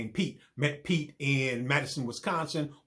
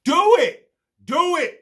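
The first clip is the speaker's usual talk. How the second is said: shrill, much higher, loud scream; screamed command; 12 dB louder than usual. Retold or imitated. Woman in the bedroom screaming at Bean, shrill and loud. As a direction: shriek it through the door. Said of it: "then I hear her scream".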